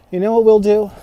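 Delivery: a lightly put-on character voice; a hint of a character voice. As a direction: say it again in a different voice. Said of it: "I do all the voices".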